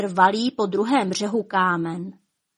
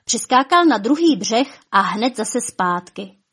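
usual speaking voice

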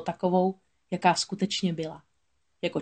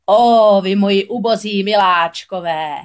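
loud, wailing lament; 9 dB louder, slower than usual. A narrator's reading. Witch mourning her struck eels, loud wailing lament. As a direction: howl over the fallen eels. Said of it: "bědovala čarodějnice".

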